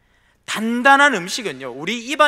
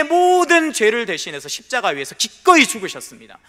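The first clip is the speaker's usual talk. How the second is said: loud fervent proclamation from the pulpit; preaching at full force; 5 semitones higher, 7 dB louder than usual. Acted. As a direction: preach it out, ringing and strong. Climactic preaching. (강단에서 힘차게 선포하듯)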